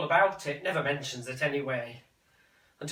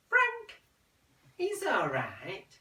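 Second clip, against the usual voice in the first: put-on high, light elderly mother; mother's voice; 5 semitones higher, much slower than usual. Son reporting his mother's fond praise, high and light. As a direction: voice the mother brightly.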